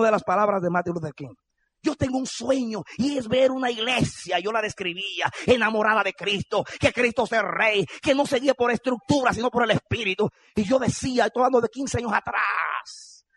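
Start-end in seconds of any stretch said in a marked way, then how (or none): 1.8-13.2 high, driving vision from the pulpit; impassioned preacher's cadence; fervent preaching. Real, not acted. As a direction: declare each clause like a rallying dream.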